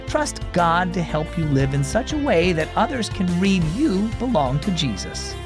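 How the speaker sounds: ordinary delivery, nothing marked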